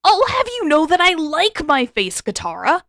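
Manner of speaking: shrill with nerves, protest; panicky high voice. Acted. Sokka defending his face to Katara, high and panicky. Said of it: "voice higher than it should have been".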